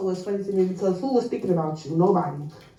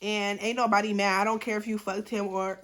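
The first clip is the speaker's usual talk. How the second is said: usual speaking voice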